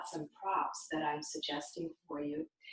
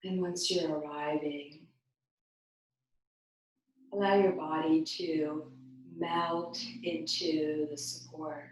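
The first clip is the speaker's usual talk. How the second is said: guided meditation; 2 semitones lower than usual. Real, not acted. Yoga teacher guides bodies to melt into support, guided meditation. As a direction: ease them down, phrase by phrase. Leads body relaxation.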